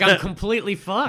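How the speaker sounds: high, loud mock despair; imitating dismayed realization. Stu spotting the planted gun, imitated loud despair. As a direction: exaggerate the doomed realization. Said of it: "he sees the outline of a gun".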